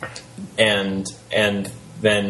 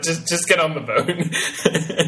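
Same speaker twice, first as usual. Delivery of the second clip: put-on slow, higher, laughing drunk god; drunken Greek god voice; 7 semitones higher, slower than usual. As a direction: coax like a tipsy party god. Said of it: "your drunken Greek god voice".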